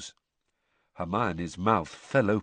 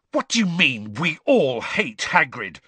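indignant retort at a sneering rival; spat; 7 semitones higher, 4 dB louder than usual. Acted. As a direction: spit it back at him in disbelief. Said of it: "Harry spat at Malfoy".

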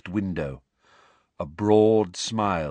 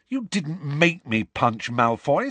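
slow, higher, a touch of heat; angry; 7 semitones higher, slower than usual. A narrator's reading. Harry to Ron, brushing off his blame, mildly angry. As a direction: press each word out in understated anger. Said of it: "said Harry angrily".

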